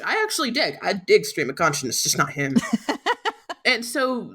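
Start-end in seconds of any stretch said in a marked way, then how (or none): 2.6-3.6 high short laugh. Real, not acted. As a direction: laugh after your own jab. Laughs at own jab.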